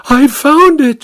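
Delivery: very high-pitched, urgent announcement; housemaid's high, breaking-news cry; excited cry. A narrator's reading. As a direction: cry out the discovery. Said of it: "she cried".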